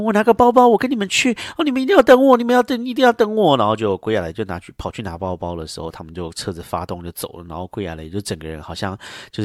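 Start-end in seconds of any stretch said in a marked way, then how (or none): 0.0-3.6 much higher pleading voice; hammed-up eager mother. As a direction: beg to come along, voice up high. Mother begging them to wait.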